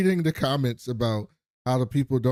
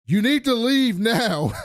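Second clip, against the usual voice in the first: stern boss order; 11 semitones higher, 4 dB louder than usual. Retold or imitated.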